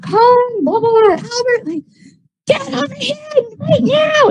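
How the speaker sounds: shrill high mock mom scream. Angry mom calling her son away from the party, high mock scream. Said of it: "came screaming down the stairs".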